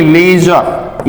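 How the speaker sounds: लेजर with a long "ay" in the first syllable; teacher's careful लेजर with long ay. Shown as a demonstration. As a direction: say लेजर as "lay-zer", slowly. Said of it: "ए या फिर ए long sound".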